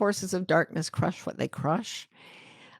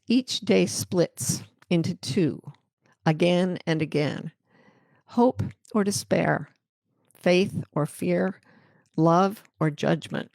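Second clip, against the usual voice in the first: poem reading. Acted. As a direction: read it like a poem, letting each line land. Reads Mary Walker's poem aloud.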